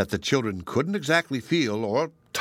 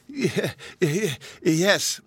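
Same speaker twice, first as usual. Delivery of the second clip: nervous stammered yes, higher; stammering; 8 semitones higher, much slower than usual. A narrator's reading. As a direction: stumble over the yes. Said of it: "said Jehoshaphat".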